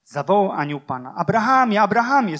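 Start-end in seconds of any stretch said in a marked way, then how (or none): 1.1-2.4 calling a name loudly from above; angel calling out. Acted. Angel calling down to Abraham, loud urgent call. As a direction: call out to him from heaven, urgent.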